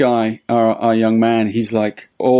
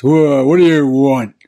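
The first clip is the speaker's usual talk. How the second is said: louder, slurring tramp voice; drunken tramp; 2 semitones higher, 5 dB louder than usual. Retold or imitated. Tramp answers old man's call, loud rough drunk voice. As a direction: answer like a rowdy drunk being interrupted. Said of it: "the tramp goes".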